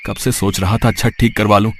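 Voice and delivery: ordinary delivery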